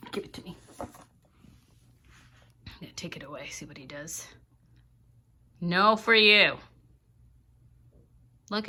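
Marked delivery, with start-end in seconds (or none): 0.1-4.3 much softer, under the breath; hushed aside to the viewers; quiet aside. Real, not acted. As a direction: murmur it so the bird won't notice. Quiet aside while taking item.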